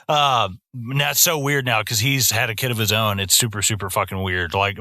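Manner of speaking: usual speaking voice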